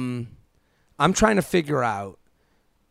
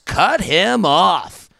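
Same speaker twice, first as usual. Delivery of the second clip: slow, drawn-out "cut him off" in a put-on voice; mock breezy dismissal; 3 semitones higher, 6 dB louder, much slower than usual. Mocking her friends' brunch-table advice, drawn out. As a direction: dismiss him like it's nothing.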